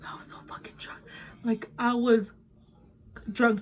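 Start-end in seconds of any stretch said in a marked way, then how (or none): 0.1-1.0 whispered confession. Real, not acted. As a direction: tell it in a conspiratorial whisper.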